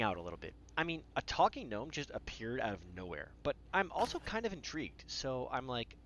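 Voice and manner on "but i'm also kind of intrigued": ordinary delivery, nothing marked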